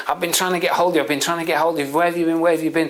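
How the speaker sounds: urgent repeated demands, acted out; panicking father. Father finally reaches his son, acted panic. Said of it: "the father's panicking and panicking and panicking".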